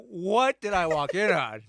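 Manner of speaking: loud, high, drawn-out disbelief; shocked outcry. Acted. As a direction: exclaim it slowly and loudly, mock appalled. Acted Magneto's startled reaction.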